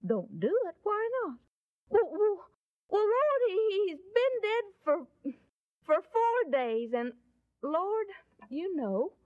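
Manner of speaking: high-pitched, stammering objection; comically agitated protest with jumps in pitch; flustered Martha. Retold or imitated. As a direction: act flustered, restarting and stalling on the words. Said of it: "Martha got all flustered and said".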